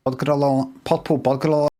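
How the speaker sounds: "purple burglar alarm" mashed into "potpol boglalom"; "burglar" with short o and swallowed r; tongue-twister mispronunciation. Shown as a demonstration. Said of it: "watch him try and say it".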